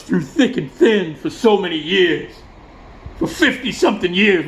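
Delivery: imitating a choked-up singer's speech; halting, overwhelmed, with long pauses; hammed-up tearful gratitude; mock crying. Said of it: "And then he starts crying".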